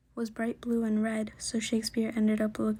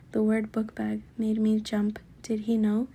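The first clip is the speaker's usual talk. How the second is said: plain reading voice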